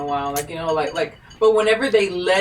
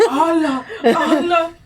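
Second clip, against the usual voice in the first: mock panicked boyfriend; 8 semitones higher, 4 dB louder, slower than usual. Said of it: "your dude's like".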